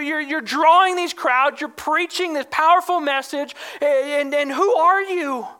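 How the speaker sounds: acting out a delegation pressing for an answer; frustrated interrogation. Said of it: "It shows frustration".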